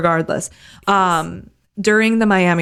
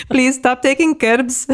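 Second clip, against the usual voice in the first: higher, hammed-up French-German accent; mock engineer accent; 5 semitones higher than usual. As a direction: beg the driver in a bad accent. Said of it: "Our accents are so off".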